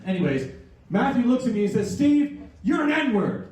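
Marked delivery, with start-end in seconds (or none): 1.9-3.5 put-on kid's insult. Kid yells insult at him.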